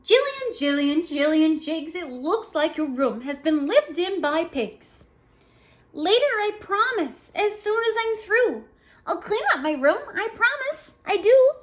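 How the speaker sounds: loud sing-song read-aloud refrain; voiced rhyme dialogue. A narrator's reading. Reading the refrain to kids, high sing-song.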